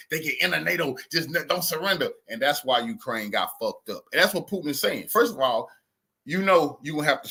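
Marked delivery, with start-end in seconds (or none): none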